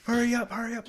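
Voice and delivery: high-pitched imitation of an angry boss; mock abusive yelling. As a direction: hurl insults as the raging boss, half-voiced. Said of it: "calling you an idiot".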